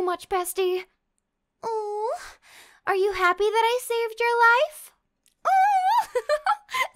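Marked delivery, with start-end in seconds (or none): 5.5-7.0 giggle. Real, not acted. Laughs after being thanked.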